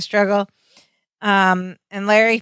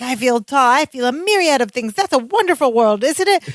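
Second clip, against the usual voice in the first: rapid, rising, higher manic babble ending in a shout; fast manic rant; 5 semitones higher, faster than usual. Retold or imitated. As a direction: rattle it off like a man on speed. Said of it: "ranting real fast".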